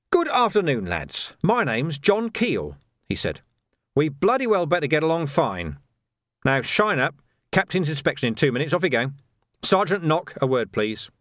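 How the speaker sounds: brisk parade-ground sergeant, lightly acted; commanding sergeant. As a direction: take charge of the men firmly. New sergeant addresses the squad.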